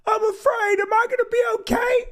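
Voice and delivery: high, small, frightened little voice; tiny scared voice. Pretend. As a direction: squeak it up like a scared kid looking up. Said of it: "looking up at her going".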